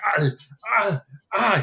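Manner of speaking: high, loud strained grunting; hammed-up strained ah ah ah; mock grunts. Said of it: "and Richards is like".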